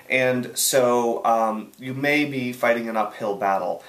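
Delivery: ordinary delivery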